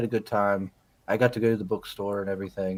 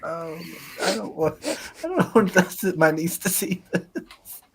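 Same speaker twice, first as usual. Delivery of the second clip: higher-pitched mock discomfort, breaking into a laugh; embarrassed little protest, pitched up; squirming dismay; 6 semitones higher than usual. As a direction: squirm through it, half laughing. Acted unease at niece seeing this.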